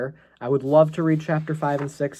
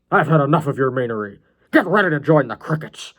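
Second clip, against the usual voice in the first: loud put-on villain threat; angry, deranged John; 6 dB louder than usual. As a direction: burst out in hammed-up menace.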